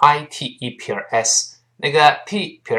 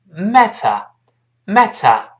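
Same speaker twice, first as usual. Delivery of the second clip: British "matter" with crisp t and no final r; 5 semitones higher, 4 dB louder, much slower than usual. Shown as a demonstration. Teacher contrasting accents for learners, British pronunciation of "matter". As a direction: slowly say British "MAT-uh". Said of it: "英国人读出".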